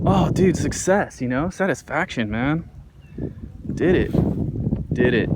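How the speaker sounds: loud happy cheering; excited. Real, not acted. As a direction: celebrate the catch out loud. Celebrating landing the fish.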